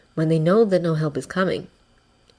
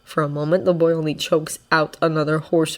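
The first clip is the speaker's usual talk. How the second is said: plain reading voice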